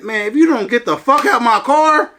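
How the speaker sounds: a firm, threatening repeat of the order; aggressive threat. Retold or imitated. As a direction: lean on it, ready to fight. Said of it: "I said it aggressively".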